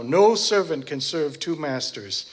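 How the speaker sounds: ordinary talk, nothing marked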